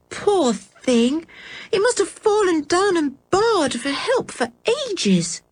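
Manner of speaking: put-on high girl's voice full of pity; pitying little girl. A narrator's reading. Anne pitying the dead sheep, high voice. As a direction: feel sorry for the poor sheep.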